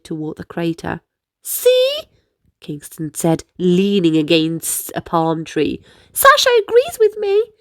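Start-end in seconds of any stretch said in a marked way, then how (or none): 1.4-2.0 put-on high, bright little boy; cheeky younger brother. Kingston's high voice. 6.1-7.5 put-on high, bright little boy; cheeky younger brother. Kingston's high voice.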